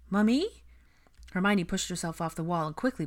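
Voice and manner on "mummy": high, rising little call; anxious child. A narrator's reading. A frightened boy calls his mother again, anxiously. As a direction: call for mother, small and uneasy.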